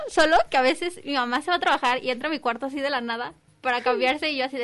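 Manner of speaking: ordinary talk, nothing marked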